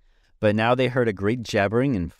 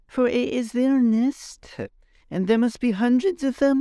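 Tip's voice. A narrator's reading. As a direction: pitch up high and draw it out. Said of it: "said Tip".

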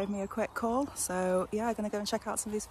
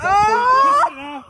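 loud shriek into laughter; shouting laugh; 13 dB louder than usual. Real, not acted. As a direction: burst out in a high shouting laugh. Reaction to drink effect.

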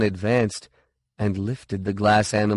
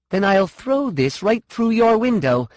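threatening; 11 semitones higher, 5 dB louder than usual. A narrator's reading. Sean threatens.

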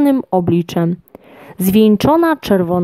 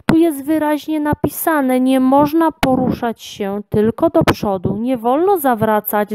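mildly cross, higher at the start; angry lion; 7 semitones higher than usual. A narrator's reading. Lion tells the girl off for turning back, mildly angry. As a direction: scold with a touch of anger. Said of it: "zezłościł się lew".

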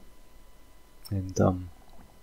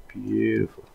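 Australian beautiful; slower than usual. Retold or imitated. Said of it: "That's what people say here".

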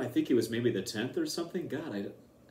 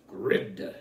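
mock butch; 6 semitones lower than usual. Joking with a friend about the old name, mock butch. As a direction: lower butch voice on one word. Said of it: "Sounded so butch, didn't it?".

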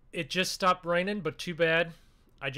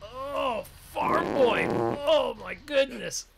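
surprised; 7 semitones higher, much slower than usual. Acted. Surprised by farm boy arriving.

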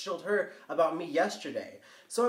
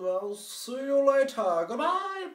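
singsong goodbye; 10 semitones higher, 5 dB louder, slower than usual. Real, not acted. Singsong sign-off to viewers.

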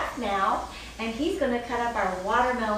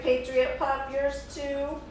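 ordinary delivery

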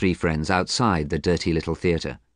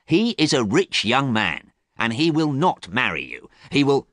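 raised, insistent warning; angry; 6 semitones higher than usual. A narrator's reading. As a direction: press the point crossly, pitched up. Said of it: "James said again angrily".

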